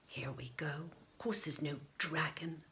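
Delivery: unvoiced eye-rolling whisper; sceptical whisper. A narrator's reading. Morwenna scoffs to herself about the dragon, whispering. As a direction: whisper it like a doubting child. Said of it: "thought Morwenna".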